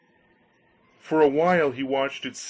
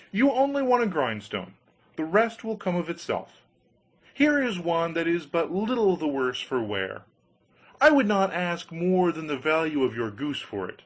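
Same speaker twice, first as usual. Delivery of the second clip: persuasive grinder voice; 5 semitones higher than usual. Acted. Grinder sells stone.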